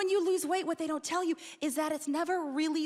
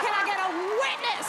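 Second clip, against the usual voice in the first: preacher call; 4 semitones higher, faster than usual. Acted.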